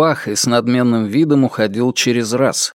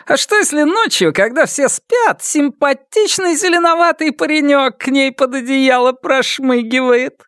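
bawdy teasing; 12 semitones higher than usual. A narrator's reading. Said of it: "скабрёзно хихикнула".

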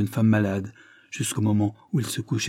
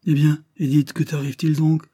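loud, startled cry out; stunned exclamation; 6 semitones higher, 6 dB louder than usual. A narrator's reading. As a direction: call out, caught off guard. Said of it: "s'écria-t-il tout interdit".

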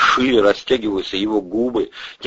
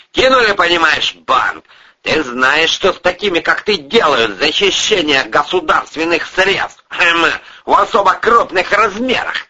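raised-pitch, indignant tirade; angry threat; 10 semitones higher, 4 dB louder than usual. Acted. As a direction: lecture the culprit menacingly. Boss threatens over stolen money.

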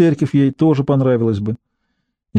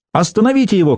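higher-pitched storyteller's cry; mild narrated shout; 8 semitones higher, faster than usual. A narrator's reading. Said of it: "крикнула Риццоли". (сдержанный выкрик рассказчика, высоким тоном)

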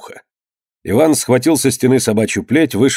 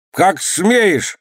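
high-pitched indignant cry; indignant governor; 9 semitones higher than usual. A narrator's reading. Governor outside the shut gate, indignant cry. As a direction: call out in outrage, pitched high. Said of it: "раздался воеводин голос".

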